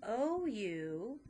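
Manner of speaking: O U spelled out very slowly; slow spelling. Shown as a demonstration. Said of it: "vowel team".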